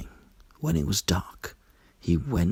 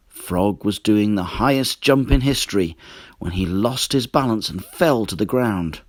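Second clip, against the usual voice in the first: plain reading voice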